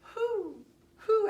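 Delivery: high, loud hooted calls; owl hoots. Shown as a demonstration. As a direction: show a class how an owl hoots. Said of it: "An owl says".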